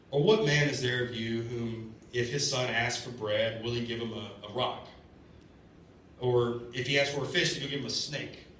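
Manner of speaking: slow, measured Bible reading; scripture reading. Acted. Preacher reading Matthew to the congregation, slow and solemn. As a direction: read the verse slowly and solemnly.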